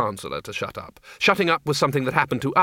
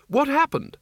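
sharply raised, urgent question; urgent high demand; 13 semitones higher than usual. Acted. Arch-Chancellor stopping a fleeing man to ask what happened, urgently. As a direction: grab him and demand an answer.

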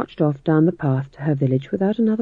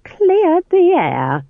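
mock sweet; 13 semitones higher, 4 dB louder, much slower than usual. Nanny's bitter parting shot.

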